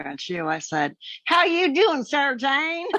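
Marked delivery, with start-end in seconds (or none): 1.3-2.9 high, lilting Southern greeting; sing-song Southern accent, pitched up; Southern gal. Retold or imitated. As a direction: imitate a Southern gal calling hello. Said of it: "there was a Southern gal there".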